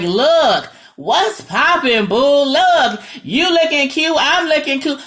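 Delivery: loud, high New York banter; animated New York greeting. Retold or imitated. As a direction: greet a friend like a New Yorker. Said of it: "We just like".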